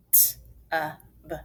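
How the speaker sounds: "T-U-B" as letter sounds, not letter names; three separate sounds with gaps; sounded out. Shown as a demonstration. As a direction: sound out tub one phoneme at a time. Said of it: "Let's finger spell".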